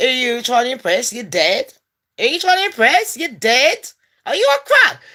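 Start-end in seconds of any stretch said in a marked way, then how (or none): 2.2-5.0 higher, pushier taunt; squeaky rising jeer at a ghost; mocking taunt. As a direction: get in the ghost's face and mock her. Taunting ghost girls, repeated.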